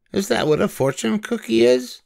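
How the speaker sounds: high, cheerful storybook voice for Amelia; Amelia Bedelia voice. A narrator's reading. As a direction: lift into a bright girlish character voice. Amelia asks.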